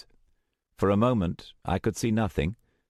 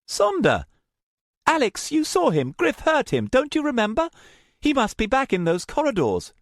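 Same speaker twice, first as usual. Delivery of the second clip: higher, louder pleading voice; pleading young woman; 5 dB louder than usual. Acted. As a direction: plead for the friend left behind. Luna pleads.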